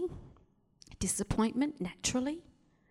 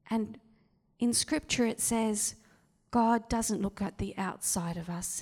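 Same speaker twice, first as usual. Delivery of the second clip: usual speaking voice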